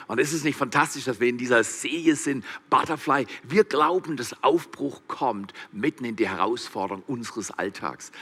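ordinary talk, nothing marked

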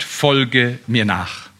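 sehr langsam gesprochen; langsam betont. Real, not acted. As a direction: jedes Wort einzeln setzen. (feierlich langsam)

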